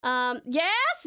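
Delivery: usual speaking voice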